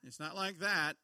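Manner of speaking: normal talking voice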